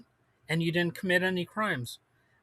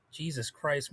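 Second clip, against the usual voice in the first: usual speaking voice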